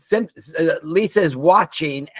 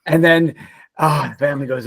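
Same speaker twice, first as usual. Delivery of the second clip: ordinary talk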